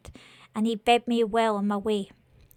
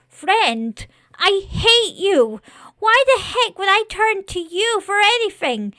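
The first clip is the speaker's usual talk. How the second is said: high, loud, hurt outburst; indignant fury; 9 semitones higher, 7 dB louder than usual. Acted. Scarecrow spurns its supposed last friend, furious. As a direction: start in disbelief, climb into loud anger. Scarecrow rejects the demon lord.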